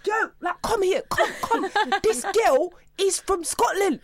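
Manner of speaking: very high, incredulous slang voice; astonished South Londoner. Retold or imitated. South Londoner tells a mate about the Scottish girl, mock astonishment. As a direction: mimic a South Londoner spreading shocking news.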